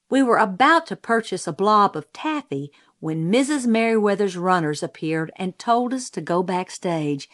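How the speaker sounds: plain reading voice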